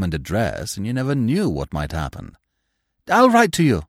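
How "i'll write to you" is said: higher, louder call; a young man racing for a cab, calling out higher and louder; cried out. Acted. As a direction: shout it over your shoulder. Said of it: "he cried".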